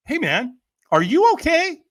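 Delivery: high, loud worried check-in; mock concerned voice; concerned stranger. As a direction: ask like a worried passerby. Retold stranger concerned question.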